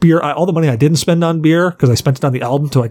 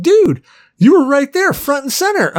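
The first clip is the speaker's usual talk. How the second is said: excited rocker voice; 12 semitones higher than usual. Retold or imitated. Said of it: "He's like".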